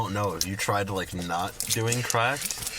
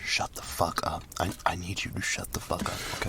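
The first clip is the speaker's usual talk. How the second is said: hushed lawyer whisper, a bit faster; softer, quick whisper from lawyer to client; 4 semitones lower, 4 dB quieter than usual. Pretend. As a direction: lean in and hiss it to the client. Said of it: "leans in and goes".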